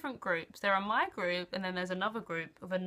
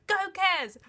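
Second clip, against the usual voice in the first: cheering; 10 semitones higher, 6 dB louder than usual. Retold or imitated. Friend cheering.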